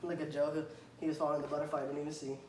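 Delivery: ordinary talk, nothing marked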